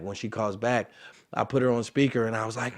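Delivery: usual speaking voice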